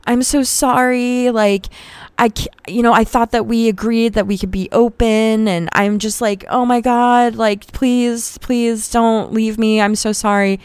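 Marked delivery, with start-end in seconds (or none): 0.0-5.5 grovel to your angry fiancee on the phone; profuse apology. Retold or imitated. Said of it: "profusely apologizing". 6.5-10.6 higher-pitched, slow, pleading apology; profuse apology. Retold or imitated. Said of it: "profusely apologizing".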